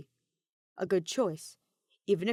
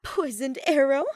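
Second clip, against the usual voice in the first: higher, louder scoffing through laughter; mocking laugh; 7 semitones higher, 8 dB louder than usual. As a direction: laugh off the threat. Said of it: "had the nerve to laugh".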